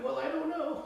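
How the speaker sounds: high, soft, hesitant voice; a timid soul murmuring unsure; quiet timid person. Acted. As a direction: play the shy one who won't commit. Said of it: "a quiet person".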